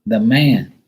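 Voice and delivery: loud, drawn-out hello; excited greeting. Real, not acted. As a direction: call out the name big and slow.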